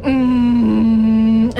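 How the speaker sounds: long throaty yoga breath; ujjayi breath hum. Real, not acted. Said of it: "it's my ujjayi breathing".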